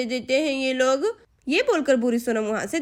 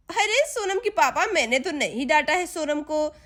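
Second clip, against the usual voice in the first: ordinary delivery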